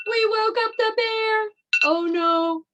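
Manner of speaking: high-pitched mock panic; pretend alarm. Teacher who has woken a pretend bear, mock alarm. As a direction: act scared for young children.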